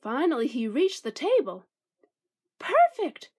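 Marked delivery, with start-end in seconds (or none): 2.6-3.3 squeaky mouse voice. A narrator's reading. Mouse finds a cozy spot.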